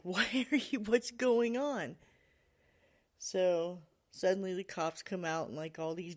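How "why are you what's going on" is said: higher, louder confused question; retold bewilderment, drawn out at the end; bewildered kid. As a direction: imitate a boy demanding to understand. Bewildered kid questions police presence.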